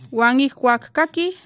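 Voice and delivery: ordinary delivery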